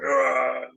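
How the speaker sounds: high, loud groan of effort; mock strain under a heavy bar; straining grunt. Lifter going for a personal best, straining grunt. Acting out a heavy bench press.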